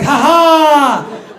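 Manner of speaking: loud, high whooping laugh; mock guy's "ha ha". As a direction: imitate a man crowing with delight. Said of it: "one of those guys that's like".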